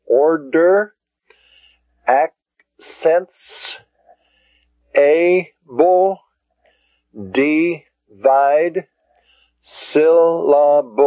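"order, accents, able, divide, syllables" broken into slow, separate syllables. Shown as a demonstration. Teacher showing the divisions in "order, accents, able, divide, syllables", syllable by syllable. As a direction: split "accents" as ac-cents and "syllables" as syl-la-bles, with gaps. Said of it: "those five words that have more than one syllable".

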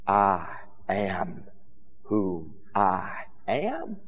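very soft, slow and low, words set apart; hushed reverence. Acted. Preacher quoting the divine name to the congregation, hushed reverence. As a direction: let each "I am" hang in the quiet.

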